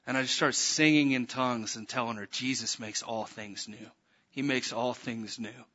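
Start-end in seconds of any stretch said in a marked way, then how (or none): 2.3-5.6 low, soft, slow and reverent; tender assurance. Retold or imitated. Minister weeping with joy over a scarred girl, tender assurance. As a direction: tell it gently, letting each word settle.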